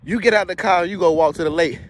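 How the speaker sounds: normal talking voice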